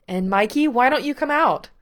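a loud, rising stage call; calling out to crowd. A narrator's reading. Gerard calling his brother onstage before the crowd, loud and bright. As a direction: announce him like a showman from the mic.

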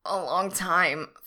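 loud, strained confession; strained cry. Acted. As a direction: let it break out of him. Overwhelmed lover cries out.

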